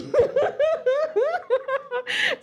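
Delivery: shrieking high laugh; high burst of laughter. Real, not acted. Vlogger cracking up at her own slang example, high laughing. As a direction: let out a squealing laugh.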